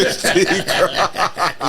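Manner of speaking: high, very slow yardie drawl with laughter; Jamaican accent. Retold or imitated. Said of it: "His Jamaican accent".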